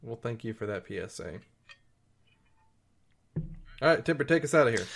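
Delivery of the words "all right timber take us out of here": announcer voice. Acted. Podcast outro sign-off.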